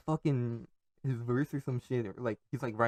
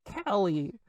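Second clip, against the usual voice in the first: mocking a poser; 9 semitones higher than usual. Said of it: "the other one says".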